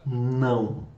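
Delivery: normal talking voice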